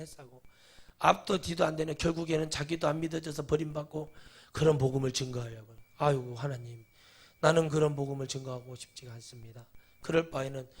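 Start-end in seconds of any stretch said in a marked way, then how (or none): none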